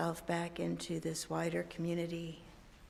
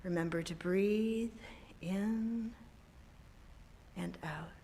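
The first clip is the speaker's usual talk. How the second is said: guided meditation; 7 dB quieter than usual. Acted. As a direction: guide them gently into calm. Leads a meditation.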